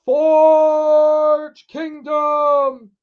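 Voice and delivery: high, loud, hugely drawn-out rallying cry; slow, stretched hype bellow; slogan shout. Acted. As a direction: belt each word on one long held note.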